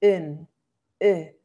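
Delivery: "un" y "e" dichos con la vocal holandesa redondeada; "un" como entre u y e, fuerte; Dutch un sound. Shown as a demonstration. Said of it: "Todo eso suena igual". (vocal "e" redondeada del holandés)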